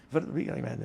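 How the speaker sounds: put-on "What a young man" in a light Austrian accent, w as v; an old Austrian bartender's greeting, lightly voiced. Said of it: "he still had his Austrian accent".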